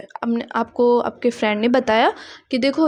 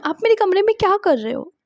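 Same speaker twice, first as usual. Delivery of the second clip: acted question; 7 semitones higher than usual. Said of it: "आप पूछ रहे हैं".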